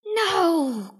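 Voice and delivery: high-pitched cry of protest. A narrator's reading. Jungle animals answer the hungry lion, crying out. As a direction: cry out as frightened little animals. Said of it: "cried the jungle animals".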